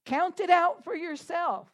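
ordinary delivery

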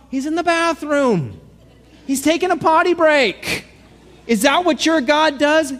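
Elijah taunting the prophets of Baal, high and scornful; thick sarcasm. Retold or imitated. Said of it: "The sarcasm is thick".

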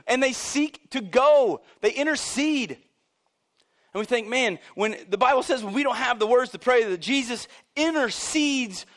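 loud, high, impassioned preaching; loud, high preacher at full throttle; full-force preaching. Real, not acted. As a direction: drive it home like a revival preacher.

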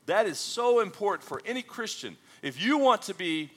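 rising, emphatic sermon voice; preaching. Acted. Pastor preaching to the congregation, emphatic and rising. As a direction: drive each point home to the church.

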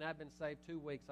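ordinary delivery

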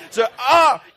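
high, loud hammed-up shriek; comic startled yelp; mock scream. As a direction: let out a fake horror-movie scream. Scary moment in the movie.

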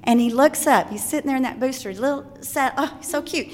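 ordinary delivery

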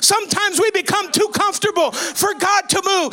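loud, driving revival preaching; full-force preaching. Real, not acted. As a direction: cry it out to the congregation, stretching key words.

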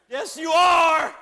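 slow, high, full-force shout; drawn-out triumphant yell; saints shouting. Retold or imitated. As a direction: roar it as a congregation answering. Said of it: "the saints around said".

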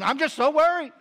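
loud mock wail of worry; hammed-up worried announcing; announced worry. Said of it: "she announces to the plane".

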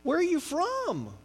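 high, slow, friendly drawn-out barista; chatty Texan barista. Retold or imitated. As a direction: play the chatty Texas coffee server. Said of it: "they're like".

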